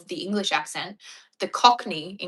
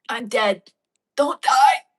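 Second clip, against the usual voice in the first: playful drama; 5 semitones higher, 11 dB louder, slower than usual. Pretend. Playful exchange voiced with the pet.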